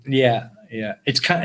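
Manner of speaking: ordinary delivery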